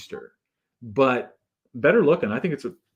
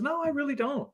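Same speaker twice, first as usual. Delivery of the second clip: smiling refusal; 13 semitones higher, 6 dB quieter than usual. Retold or imitated. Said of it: "saying it with a smile on her face".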